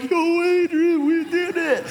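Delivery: slow, high movie-boxer victory cry; drawn-out triumphant call to Adrian; hammed-up boxing hero impression; Rocky. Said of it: "you know, Rocky on the Rocky movie series".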